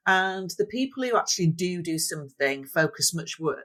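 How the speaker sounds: ordinary talk, nothing marked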